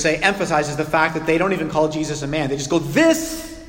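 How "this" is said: high, loud, sputtering with rage; furious stammer. Retold or imitated. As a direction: choke on the words in fury. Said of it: "They, they're so livid".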